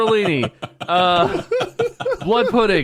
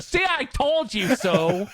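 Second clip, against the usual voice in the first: slow, smug and higher; smug told-you-so; 7 semitones higher, slower than usual. Retold or imitated. Husband gloating over the mess, slow smug told-you-so. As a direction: drag it out smugly. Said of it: "In that tone".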